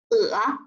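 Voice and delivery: 呃 dipping low, then rising; drawn-out dipping 呃; third tone. Shown as a demonstration. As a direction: bend 呃 down and back up. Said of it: "连在一起我们来说一下".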